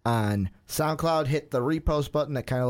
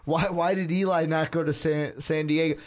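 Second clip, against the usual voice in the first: ordinary talk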